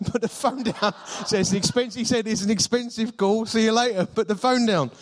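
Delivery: high, laughing retelling; amused laughter. Real, not acted. Pastor tells church about the abrupt hang-up, amused and high-pitched.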